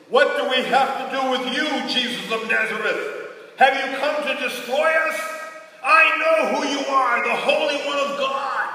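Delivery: loud, high demon's cry from the synagogue. Acted. Man with unclean spirit crying out at Jesus, loud and high. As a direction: shout it like a possessed man confronting Jesus. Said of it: "And he cried out".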